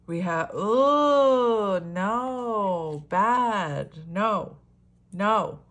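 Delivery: slow, high, loud drawn-out oh no; dismayed surprise. Real, not acted. Reader seeing the infidelity card, dismayed. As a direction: react like you saw bad news.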